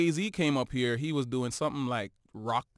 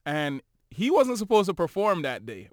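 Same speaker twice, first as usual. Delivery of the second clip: ordinary talk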